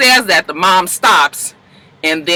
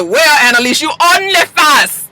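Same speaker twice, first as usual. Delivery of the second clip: put-on loud, high Belizean accent; playful put-on Belizean accent; 9 semitones higher, 5 dB louder than usual. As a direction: call her out in a Belizean accent. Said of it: "That's my Belizean accent".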